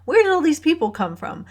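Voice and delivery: mock disbelief at the crowd; retold amazement at the crowded operating room; startled question. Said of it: "I'm like".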